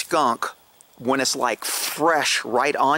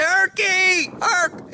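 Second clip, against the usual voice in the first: calling a name; much slower than usual. Retold or imitated. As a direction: call the dog's name out far. Calling for lost dog.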